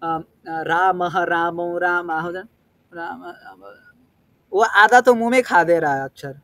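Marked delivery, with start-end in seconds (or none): 0.1-3.7 रामः fading to a breathy unvoiced राम; swallowed ending. Shown as a demonstration. Teacher showing how a weak reader eats half the syllable, fading. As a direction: say रामः, रामो, रामाह, then राम fading away. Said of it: "जिस प्रकार से उच्चारण करना चाहिए वो नहीं कर रहा है".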